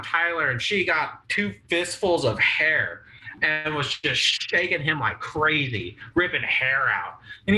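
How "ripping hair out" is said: ordinary delivery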